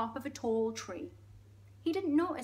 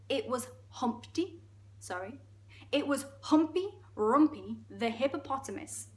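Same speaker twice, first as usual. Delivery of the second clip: ordinary delivery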